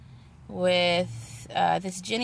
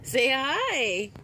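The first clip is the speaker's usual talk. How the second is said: higher, sing-out prompt to greet; insistent coaxing; 6 semitones higher than usual. Real, not acted. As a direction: coax more brightly, pitch swooping up high. Repeats the coaxing after no answer.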